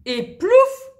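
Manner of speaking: high, loud plouf sound; playful splash. Acted. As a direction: make the splash leap out. Kite falls into water.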